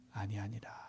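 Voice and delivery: the verse trails off into a whisper; whispering the last word of Scripture; whispered verse ending. Acted. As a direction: drop to a hush to close the verse. Reading verse, fades to whisper.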